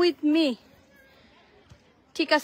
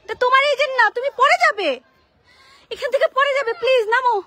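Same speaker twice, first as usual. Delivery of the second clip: higher, louder worried pleading; anxious pleading; 8 semitones higher, 6 dB louder than usual. Real, not acted. Mother begging her son to climb down, anxious and higher. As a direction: beg him to get down now.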